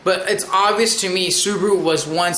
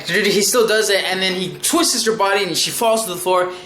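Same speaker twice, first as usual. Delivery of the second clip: ordinary talk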